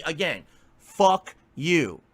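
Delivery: drawn out and pointed, each word spaced; slow deliberate insult. Real, not acted. Streamer to rude chatters, a slow pointed fuck you. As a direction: spell it out slowly so they get it. Angry at chat, repeats insult.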